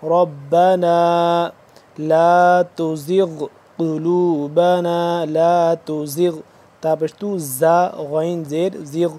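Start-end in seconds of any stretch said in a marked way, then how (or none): 0.0-6.4 ربنا with a heavy, full ra, drawn out slowly; tajweed recitation. Shown as a demonstration. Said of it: "را کو موٹا کر کے پڑھیں گے". (ربنا میں را موٹا کر کے آہستہ تلاوت)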